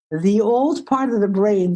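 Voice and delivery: usual speaking voice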